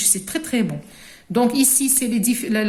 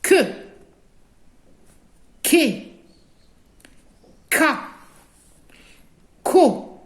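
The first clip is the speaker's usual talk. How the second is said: Que, Ke, Ka, Ko: each syllable said alone, crisp, with long pauses; teacher reading syllables one by one for the class to echo; syllables modelled clearly for children to repeat; 2 semitones higher, slower than usual. Shown as a demonstration. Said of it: "vous répétez derrière moi".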